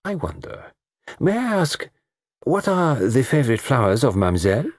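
put-on delicately courteous, lightly accented request; gallant murmur. Detective being gallant to a dark beauty, murmured politely. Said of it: "He murmured".

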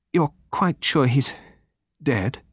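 slow, muffled question held in check; tense, restrained. A narrator's reading. Daughter asking Poirot over the body, tense and restrained. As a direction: hold the feeling back, tense and halting. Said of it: "in a low, tense voice, curiously restrained and muffled".

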